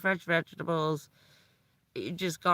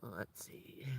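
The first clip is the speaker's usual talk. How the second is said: whispered aside; 8 semitones lower, 19 dB quieter than usual. Real, not acted. Muttering to herself while planning.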